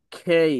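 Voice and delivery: খেই with a strong breathy kh; aspirated K. Shown as a demonstration. Teacher telling the class to say K as খেই, aspirated. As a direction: push air hard on the খ in খেই. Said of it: "pronounce করব".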